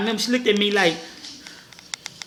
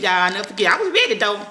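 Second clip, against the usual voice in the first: ordinary delivery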